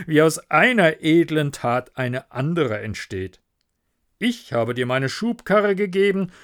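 a lightly voiced, self-pleased miller, loud on his own gain; the smug miller wheedling a favour; greedy miller. The miller to Hans about the plank, smug and wheedling.

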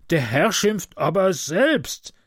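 slow, cowardly defensive reply; timid retort. Acted. Conductor answering the crowd, slow and cowardly. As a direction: back down while still protesting. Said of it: "entgegnet Podjagin feige".

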